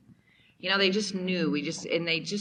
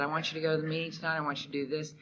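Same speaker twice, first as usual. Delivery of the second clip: fast; 4 dB quieter, much faster than usual. Retold or imitated. Husband's quoted instruction.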